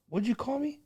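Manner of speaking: high startled question; surprised old man. Retold or imitated. Old Marine startled, mock surprised high question. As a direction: pitch up in disbelief. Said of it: "the old man looked at me like".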